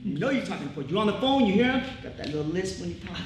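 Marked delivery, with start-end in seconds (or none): none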